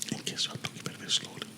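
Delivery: very soft whisper; faint whispered test phrase; whispered demo. Shown as a demonstration. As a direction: whisper it into the mic. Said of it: "now that slow part will come up".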